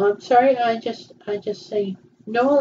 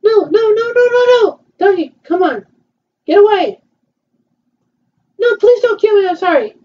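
panicked surrender shout; 8 semitones higher, 3 dB louder than usual. Pretend. Begging attacker in pretend game.

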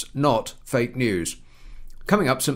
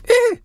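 sharp single cough; 6 dB louder than usual. Real, not acted. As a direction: clear the throat with a cough. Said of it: "Hang on, I'm gonna cough".

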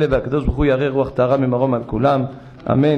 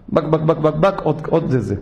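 imitated glugging, one more; mock gulping noises. The son drinking on the sly, playful glugs. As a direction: swallow noisily in the retelling.

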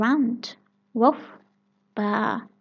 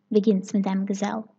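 ordinary delivery

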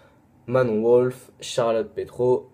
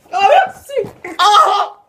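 much higher, louder delighted squeal; a burst of giddy joy, up in falsetto; squealed thanks; 10 dB louder than usual. Real, not acted. Thanking a viewer mid-shoutout, high delighted squeal.

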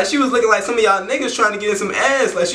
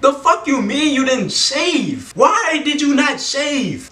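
outraged disbelief; 6 semitones higher, slower than usual. Retold or imitated. Outraged quote to the girl.

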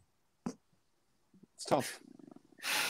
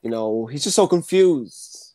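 ordinary talk

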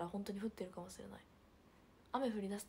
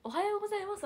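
much higher, louder おはようございます with a big lift on よ; overdone greeting; 12 semitones higher, 10 dB louder, faster than usual. Shown as a demonstration. To a senior in the club hall, an overdone loud greeting. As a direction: belt out おはようございます like an eager junior. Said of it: "はさすがに".

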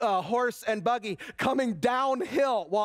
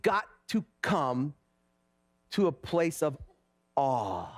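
low, soft preaching over music; reflective sermon; 8 semitones lower, 3 dB quieter than usual. Acted. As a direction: draw the congregation into quiet awe.

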